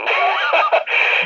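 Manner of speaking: short chuckle after the line; amused laugh. Real, not acted. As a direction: laugh lightly at your own story. Chuckles at own party story.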